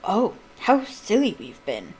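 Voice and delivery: slow, sneering drawl of scorn; mock them with a sneer; the bully Malfoy sneers at Hagrid, slow and scornful. A narrator's reading. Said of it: "Malfoy sneered".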